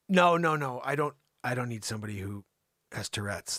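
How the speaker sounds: normal talking voice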